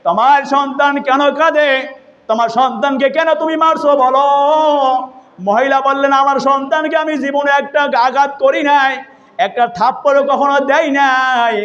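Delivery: high sing-song sermon chant; melodic preaching. Retold or imitated. Preacher retelling Omar questioning a mother, melodic preaching.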